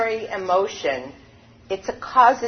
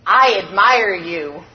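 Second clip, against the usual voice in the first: father's shout; 6 dB louder, slower than usual. A narrator's reading. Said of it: "the father began to scream".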